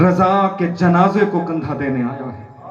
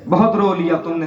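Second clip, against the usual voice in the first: ordinary delivery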